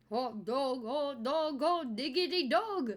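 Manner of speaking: goofy cartoon chant; Goofy impression. Goofy chanting hot dog for the stream. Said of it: "I am Goofy".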